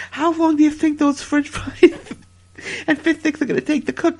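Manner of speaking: barely keeping a straight face; asking about dinner while cracking up; laughing. Real, not acted. Laughing through the question.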